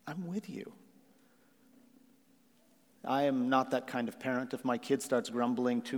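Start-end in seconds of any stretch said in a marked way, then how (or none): 0.1-0.7 very soft, lifted, tender voice; gentle reassurance. Acted. God answering his grumbling people, gently reassuring. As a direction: comfort them in a hushed, higher voice.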